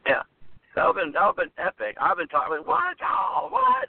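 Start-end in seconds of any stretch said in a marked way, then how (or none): 2.6-3.9 much higher, rising shout of disbelief; stunned disbelief. Pretend. Fan reacting to the twist, yelping in shock. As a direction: yelp it like you can't believe it.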